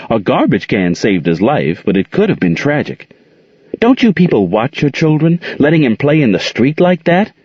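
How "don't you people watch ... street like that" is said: high, indignant scolding of a careless parent; scolding driver. A narrator's reading. Driver scolding Aunt Queen for letting the boy play in the street, high indignant scold. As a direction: scold her sharply, higher pitched.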